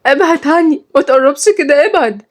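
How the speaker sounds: high, frightened warning; panicked order to keep away; alarmed. Acted. She pushes the captor away.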